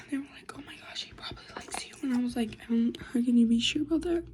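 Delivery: quick hushed whisper. Real, not acted. Whispering the story.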